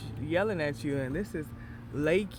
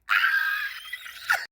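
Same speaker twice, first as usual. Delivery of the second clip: high yell; 3 dB louder than usual. Real, not acted. Shout after hype line.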